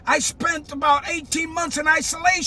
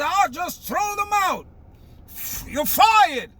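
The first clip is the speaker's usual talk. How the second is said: higher, drawn-out retold dismissal; imitating the president; 4 semitones higher, slower than usual. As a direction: mimic a boss firing people on the spot. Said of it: "Who talks like that?".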